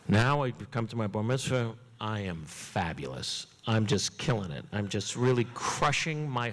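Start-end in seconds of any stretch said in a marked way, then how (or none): none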